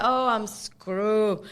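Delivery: slow, loud laughing oh I'm screwed; laughing despair. Retold or imitated. Guest recalling her panic on the phone, laughing slowly. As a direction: drag it out while laughing.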